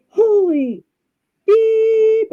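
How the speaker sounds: high, loud startled outburst, imitating husband; acted alarm. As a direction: cry out in mock shock, self-censoring. Acts out startled husband waking up.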